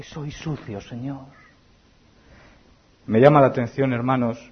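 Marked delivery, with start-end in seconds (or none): 0.0-1.4 much softer, lower; quiet confession. Acted. Leper admitting his uncleanness to the Lord, quiet. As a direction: confess it under your breath.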